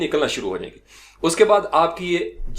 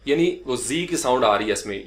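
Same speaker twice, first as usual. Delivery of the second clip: ordinary talk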